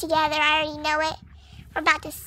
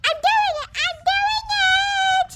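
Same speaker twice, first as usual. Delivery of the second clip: higher squeal of delight, climbing; can't believe you're pulling it off; giddy excitement; 13 semitones higher than usual. Acted. Horse thrilled at its own canter, squealing higher. Pony cheering during canter.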